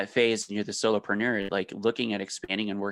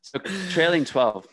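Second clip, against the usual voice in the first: Australian accent; 8 semitones higher, 6 dB louder than usual. Shown as a demonstration. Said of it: "I could slip into my Australian accent".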